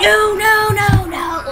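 high, rapid-fire pleading; high-pitched dismay; real panic. Real, not acted. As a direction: panic as the fight turns on you. Panicking mid-fight.

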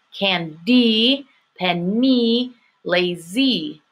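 candy, penny, lazy with stretched EE endings; syllable-split words, long second syllables. Shown as a demonstration. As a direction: lean on each final EE. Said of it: "it has the E sound".

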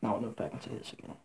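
very quiet, rushed, low murmur; close to tears. Real, not acted. Teller nearing the sad ending, choked up. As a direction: barely get it out, holding back real tears. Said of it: "If I break out into tears".

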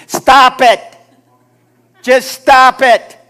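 loud, high exasperated shout; booming, drawn-out command; shouted rebuke. Acted. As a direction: yell it as God cutting off the whining. Voices God's loud rebuke.

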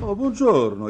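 high, loud joyful hello; surprised and glad to see him; delighted greeting. Acted. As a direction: greet an old friend with a burst of joy. Surprised friend greets Ivan warmly.

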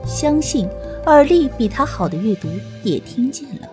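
usual speaking voice

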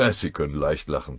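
usual speaking voice

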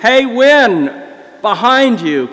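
high, loud friendly hail; imitating a friendly driver calling out. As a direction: call out brightly to get someone's attention.

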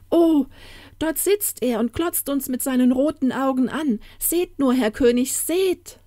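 high, frightened woman's cry; alarmed calling out. Acted. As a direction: urge him to look, in alarm. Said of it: "rief sie".

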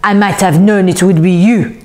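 angry, put-on voice of a fussy man; indignant little tyrant; Monsieur Crown. A narrator's reading. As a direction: accuse with theatrical exasperation. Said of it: "glared angrily at them".

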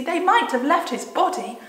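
put-on high, loud plaintive girl; storybook distress; acted sobbing. A narrator's reading. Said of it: "said, sobbed Lucy".

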